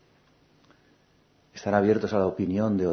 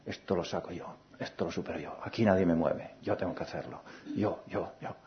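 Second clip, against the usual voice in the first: stubborn inner voice; 2 semitones lower, 7 dB quieter than usual. Retold or imitated. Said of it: "hemos querido ser rígidos, duros".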